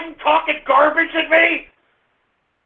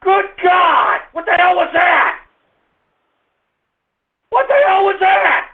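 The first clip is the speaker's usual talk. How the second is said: shouting; 5 semitones higher than usual. Real, not acted. Host yelling at a prank caller.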